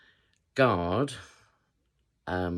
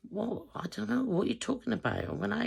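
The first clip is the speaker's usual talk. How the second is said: bewildered protest. Retold or imitated. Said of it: "I was like".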